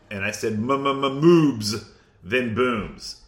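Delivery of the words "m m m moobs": M-M-M-MOOBS with a slow stuttered first sound; playful stutter. Shown as a demonstration. Co-host replaying his own joke, slow stuttering M-M-M-MOOBS. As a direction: stutter the m, then drag out MOOBS.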